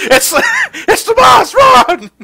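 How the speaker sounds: high, loud mock alarm; mock-panicked shout. Co-host joking about fleeing plants, mock-panicked shout. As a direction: yell a comic warning to flee.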